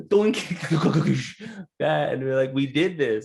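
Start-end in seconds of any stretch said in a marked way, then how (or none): 0.1-0.4 high, loud cartoon doink; mock sound of the ball hitting the cereal; ball bouncing doink.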